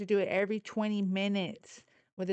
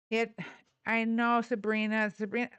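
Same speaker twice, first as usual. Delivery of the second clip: ordinary talk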